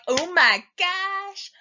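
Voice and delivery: high, loud, long drawn-out squeal of excitement; hyped-up fox. Acted. Fox off to the miller's wedding, wildly excited. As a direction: stretch it out like an over-excited party fox. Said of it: "and the fox is like".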